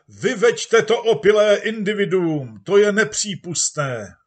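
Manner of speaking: high, loud outraged calling; crowd shouting. Acted. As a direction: yell it as an angry crowd. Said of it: "volala masa pracujících". (hlasité rozhořčené volání davu)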